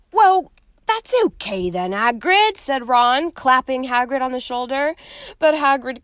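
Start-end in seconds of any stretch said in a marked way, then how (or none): 0.1-2.6 put-on higher, louder, cheerful young voice; Ron reassuring. Ron claps Hagrid's shoulder, brightly higher. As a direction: cheer him up.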